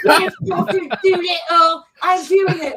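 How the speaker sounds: high, loud, drawn-out mock Cockney scream. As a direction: yell it as a hammed-up Cockney impression. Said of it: "They were screaming Cockney all over".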